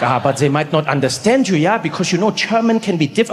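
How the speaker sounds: put-on doubtful German-accented caution; hesitant warning in a German accent; Rolf. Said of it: "He's like".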